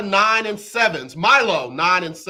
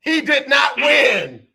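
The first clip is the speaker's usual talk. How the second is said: dramatic shout; 7 semitones higher, 5 dB louder, slower than usual. Real, not acted. Host reveals the twist dramatically.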